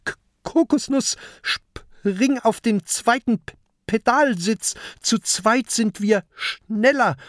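high, stuttering urgent call. Acted. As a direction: hurry your friend onto the pedals. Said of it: "ruft Peter".

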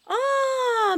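a high, loud "ah" of sudden insight; the penny drops; realization. Acted. Mother suddenly realizes the plan.

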